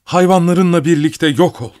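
high, furious curse; angry shout. A narrator's reading. Mother damns her brother and his cats, shouting angrily. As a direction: hurl a curse at high pitch. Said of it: "diye bağırdı".

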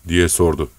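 ordinary delivery

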